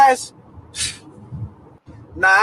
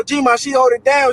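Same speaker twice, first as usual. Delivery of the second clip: ordinary delivery, nothing marked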